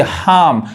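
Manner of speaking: हार्म as "haam", no r; long open vowel in हार्म, r dropped; silent r. Shown as a demonstration. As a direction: say हार्म without its r. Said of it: "इसको हार्म नहीं".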